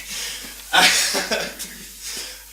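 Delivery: a big burst of real laughter; loud laugh. Real, not acted. Laughs after an apology.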